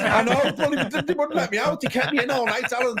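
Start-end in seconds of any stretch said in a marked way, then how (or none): 0.0-0.5 laughing. Real, not acted. Laughs into his reply.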